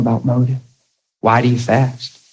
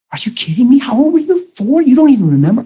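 high, shocked questions; shocked wife; 10 semitones higher than usual. Acted. Wife hearing her husband was abused as a boy, high and shocked. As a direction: gasp it out high in disbelief.

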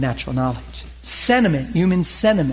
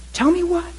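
high, urgent question; wife suddenly worried; sharp high tell me what; alarmed wife. Pretend. Wife pressing for news.

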